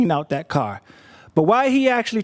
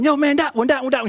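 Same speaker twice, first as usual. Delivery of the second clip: higher, keen voice picking someone out; eager pointing out; 8 semitones higher, faster than usual. Retold or imitated. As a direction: point her out eagerly, pitched up. Guys pointing out women eagerly.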